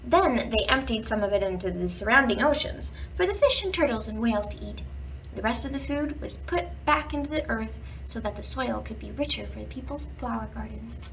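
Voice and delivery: plain reading voice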